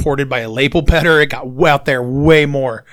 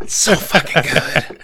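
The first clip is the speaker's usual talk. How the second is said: laughing. Real, not acted. Laughing after the singing.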